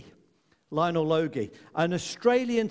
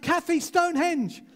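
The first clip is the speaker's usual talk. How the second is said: higher, louder exasperated calling of her name; 12 semitones higher, 4 dB louder than usual. Retold or imitated. Dad exasperated at his daughter in the car, higher louder call. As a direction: push it, losing patience.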